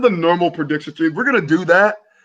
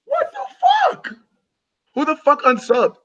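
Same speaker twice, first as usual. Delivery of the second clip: shocked outburst; 10 semitones higher than usual. Real, not acted. Shocked at losing a subscriber.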